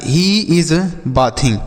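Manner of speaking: slow and careful; slow example. Shown as a demonstration. Teacher gives a slow example sentence to children. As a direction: spell it out in sound. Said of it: "जैसे".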